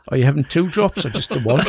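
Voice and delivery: laughing through a teasing question; laughing tease. Real, not acted. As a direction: tease him while laughing.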